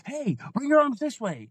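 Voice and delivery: a frantic coach getting ahead of the flyer; impatient coach snapping an order; rushed new coach. Retold or imitated. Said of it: "they go".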